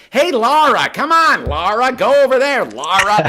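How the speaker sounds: high, loud overdone movie shout; imitated shouting. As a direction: call out to Laura at full volume, mocking the actor. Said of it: "anytime he's shouting, he's like".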